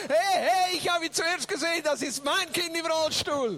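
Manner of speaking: high protesting call; possessive yelling. Retold or imitated. Interpreter as son to brothers, indignant shout. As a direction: stake your claim against rivals.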